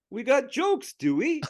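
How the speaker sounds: high, tough Philly driver challenge; Philly accent. Retold or imitated. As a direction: confront them in a Philadelphia accent. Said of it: "turns around and goes".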